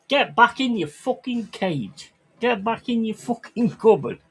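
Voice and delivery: hammed-up furious dad; mock rant. Dad ranting at his kids over a wrong greeting, mock fury. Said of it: "I'd be like".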